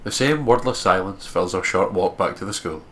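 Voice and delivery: steady storyteller read; story narration. Acted. Narrating the walk back to school, even storyteller tone. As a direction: carry the listener through the scene. Reads visual novel narration.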